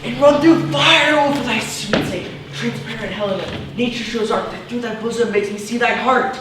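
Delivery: high, loud, ardent outburst; grand, overblown lover's fervour; impassioned declaration. Acted. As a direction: leap awake madly in love. Lysander wakes love-struck, boasts.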